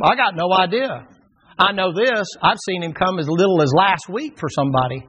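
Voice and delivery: ordinary talk, nothing marked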